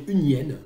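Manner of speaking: "une hyène" run together, the n sliding straight into the vowel; mute-h liaison. Shown as a demonstration. Translator showing students the correct French, mute-h liaison. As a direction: link "une" smoothly into "hyène". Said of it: "est un H muet, et donc on doit dire".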